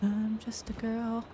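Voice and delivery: put-on soft girly voice. Repeated meme line.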